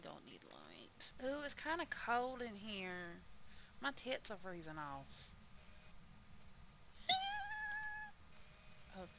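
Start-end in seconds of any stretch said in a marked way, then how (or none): 5.3-8.9 high-pitched cackling laugh. Real, not acted. Streamer cracking up at her own joke, high laugh. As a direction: burst into a high squealing laugh.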